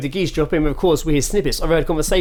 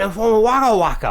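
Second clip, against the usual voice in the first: mock Geordie accent, pitched up; local Newcastle man; 8 semitones higher than usual. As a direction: garble it in an exaggerated Geordie accent. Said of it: "I swear he just went".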